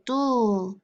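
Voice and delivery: "dull" said alone as the final syllable; isolated syllable. Shown as a demonstration. Teacher modelling the final syllable for kids. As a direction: split off "dull" clearly. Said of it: "Final syllable".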